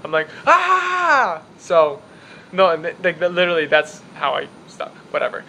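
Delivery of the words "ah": sudden high yelled "Ah!"; high scream. Retold or imitated. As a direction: scream hello into a room. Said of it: "I just start with the scream".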